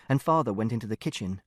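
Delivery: usual speaking voice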